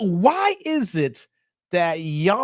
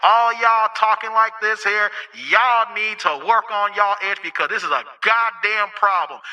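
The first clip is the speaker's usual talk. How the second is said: louder, calling out all ladies; megaphone rant; 4 dB louder than usual. Real, not acted. Host addressing all ladies, loud megaphone rant. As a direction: proclaim it like through a megaphone. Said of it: "'cause I got the megaphone out".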